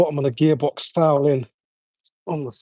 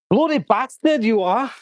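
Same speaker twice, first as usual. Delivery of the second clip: frustrated outburst; 7 semitones higher than usual. Real, not acted. Jammed part, swearing at it.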